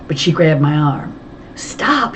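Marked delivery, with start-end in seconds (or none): 1.5-2.1 breathless whispered plea; a frightened gasped stop. A narrator's reading. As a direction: whisper it urgently, gasping. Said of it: "she gasped".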